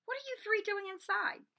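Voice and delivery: high, carrying teacher's challenge; ringing call. Acted. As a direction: ring it across the hall. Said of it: "a voice suddenly rang out".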